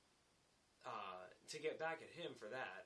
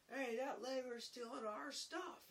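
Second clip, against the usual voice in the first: high, slow, loud mock alarm; calling out; 13 semitones higher, 5 dB louder, slower than usual. Apprentice tattling on the laborer to carpenter, shouted. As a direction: holler an accusation to a workmate.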